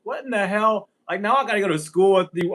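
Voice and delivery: a touch of loud exasperation; retold indignation. Retelling a freshman-year breakup at school, in mildly loud indignation.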